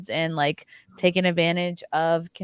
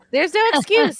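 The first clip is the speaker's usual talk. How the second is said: exaggerated high voice; 9 semitones higher, 5 dB louder than usual. Jokey high exaggerated verdict.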